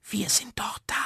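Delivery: hushed, soothing whisper; barely voiced little whisper. A narrator's reading. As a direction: whisper it softly like tiny comforters. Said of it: "vernahm er da ein Wispern".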